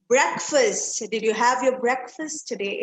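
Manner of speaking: breakfast as BREK-fust; reduced second syllable in breakfast; correct pronunciation. Shown as a demonstration. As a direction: say breakfast with a short 'brek'. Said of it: "It is not breakfast".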